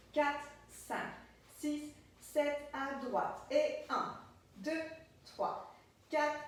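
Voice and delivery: spaced-out, rhythmic beat counting; dance teacher's count. Shown as a demonstration. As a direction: count the beats aloud, one word per move.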